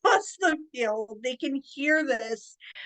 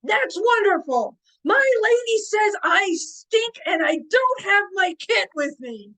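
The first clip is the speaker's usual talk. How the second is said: loud, high, hammed-up proclamation; big booming voice, easing off at the end; booming lord; 10 semitones higher, 6 dB louder than usual. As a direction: bellow it like a pompous knight. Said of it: "And he goes".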